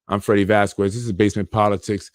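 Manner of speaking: ordinary talk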